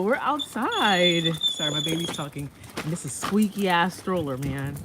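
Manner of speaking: ordinary delivery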